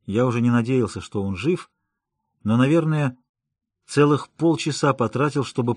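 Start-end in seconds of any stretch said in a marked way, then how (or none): none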